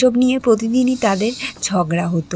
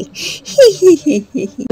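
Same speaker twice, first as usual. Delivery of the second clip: mocking laugh; 5 semitones higher, 6 dB louder than usual. Mocking laugh after insult.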